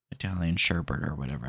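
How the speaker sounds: ordinary talk, nothing marked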